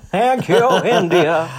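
high, loud mock gratitude; hammed-up thanks. Thanking India for the legacy left behind, hammed-up and high. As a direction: sing out the thanks through laughter.

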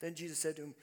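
normal talking voice

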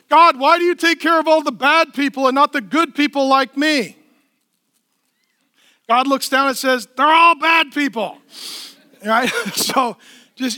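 loud, pressing sermon voice, driving every point; full-force preaching. Acted. Pastor preaching hard to a frustrated congregation, loud and driving.

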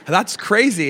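loud, high mock amazement; astonished reaction. Acting out astonished passers-by.

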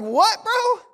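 high, loud disbelief; incredulous. Retold or imitated. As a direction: react like she betrayed you. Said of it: "I'm like".

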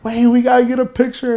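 high, loud imitation of eager fans; excited clamour. Friends grab [name] for a picture, excited. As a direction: shout it over each other, starstruck. Retold friends mobbing the star.

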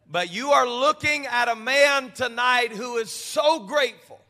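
loud, high, slow shouted preaching; preaching at full force. Acted. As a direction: build the congregation into fervor. Preaching climax.

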